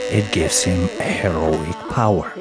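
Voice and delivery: usual speaking voice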